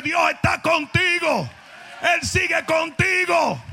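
preacher repeating it slow and loud for emphasis; emphatic repeat. Shown as a demonstration. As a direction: drive it home to the congregation. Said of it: "Yo dije".

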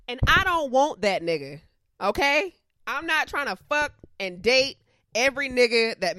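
loud, fed-up outburst; angry rant. Real, not acted. Ranting at matchmaking coworkers.

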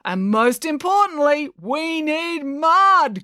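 high, very drawn-out hype-man call. A narrator's reading. Brad appealing to the crowd, calling out. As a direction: build to a long held last word. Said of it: "called Brad".

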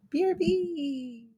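high, slow letter-by-letter sign-off; drawn-out sign-off. Real, not acted. Podcaster mutes herself for a moment, high drawn-out sign-off. Signing off before muting.

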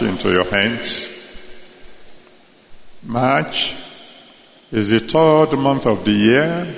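fervent, rising blessing prayer. Acted. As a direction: bless them with growing force.

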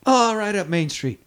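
high, drawn-out mock cry; joking mock voice. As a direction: draw it out high, as a joke. Joking Deadpool quote said back.

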